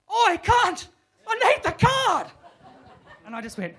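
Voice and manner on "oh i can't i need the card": much higher, louder slow yell; desperate shout. Retold or imitated. Darren yelling for his wife to bring the card. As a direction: call across the shop in panic.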